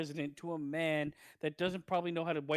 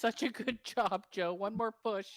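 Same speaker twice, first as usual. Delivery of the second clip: higher-pitched, cooing mock encouragement; mock doting wife; 7 semitones higher than usual. Wife coaxing Joe along, mock encouraging voice. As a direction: play a wife cheering him through it.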